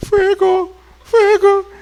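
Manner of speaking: the fire won't come out, squeak it; feeble mock fire cry. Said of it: "Ni el fuego le sale".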